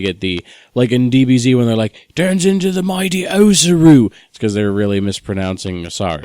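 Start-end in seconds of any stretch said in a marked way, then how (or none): 2.1-4.1 put-on announcer-style Australian accent voice. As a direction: say it like an Aussie cartoon narrator. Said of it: "Why'd you say that in an Australian accent".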